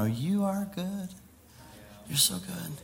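acting out struggling to sing praise; half-hearted worship. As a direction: force out faint praise, drawn out. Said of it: "it's really hard to sing".

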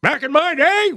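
put-on high, cranky old-timer voice; old person voice. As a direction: grumble like a grouchy old man. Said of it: "That was an old person impression".

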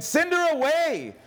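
high, slow, drawn-out urging. A narrator's reading. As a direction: read it as an insistent demand. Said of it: "came and urged him".

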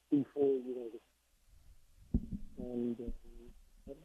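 faint, cutting-out phone line; phone breaking up. Real, not acted. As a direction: voice dropping out on a bad call. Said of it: "Phone's breaking up there".

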